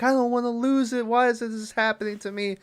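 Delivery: high whiny plea, half-mocking; lightly hammed-up voice of a woman being wheeled in; mock distressed woman.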